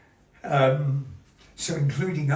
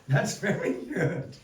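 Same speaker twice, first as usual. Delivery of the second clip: normal talking voice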